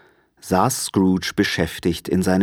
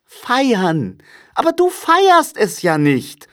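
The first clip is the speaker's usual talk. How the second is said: very high, astonished protest; high-pitched young relative, taken aback; cheerful nephew, incredulous; 4 dB louder, slower than usual. A narrator's reading. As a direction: object in lively disbelief.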